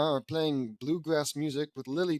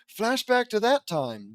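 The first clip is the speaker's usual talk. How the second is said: dramatic announcement; 8 semitones higher, 5 dB louder, slower than usual. Acted.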